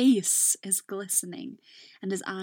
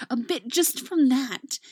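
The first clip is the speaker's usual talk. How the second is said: plain reading voice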